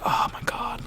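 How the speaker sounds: whispered, shared dismay; horrified whisper. Retold or imitated. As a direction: whisper it to your friend in horror. Friends share a horrified aside.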